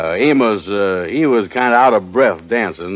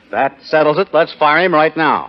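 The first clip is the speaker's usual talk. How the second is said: higher, eager repeat; excited; 6 semitones higher than usual. Acted. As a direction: jump up with excitement. Deciding to fire the Kingfish.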